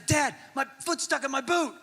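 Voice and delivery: high-pitched mock panic; freaking out. Son stuck in his boot to dad, mock freaking out. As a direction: whine it high like a panicking kid. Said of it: "he just starts freaking out".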